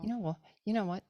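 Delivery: indignant retort. Retold or imitated. To the shopkeeper, an indignant retort. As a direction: bristle back at the shopkeeper. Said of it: "I'm like".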